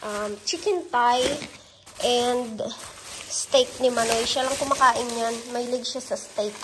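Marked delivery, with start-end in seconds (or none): none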